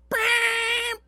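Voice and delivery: high, loud mouth-made horn beep; imitated car horn beep. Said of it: "and then went".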